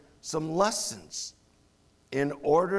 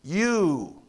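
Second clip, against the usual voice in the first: "you" pointed and stressed; emphatic you. Shown as a demonstration. Preacher explains Jesus means them, stressed "you".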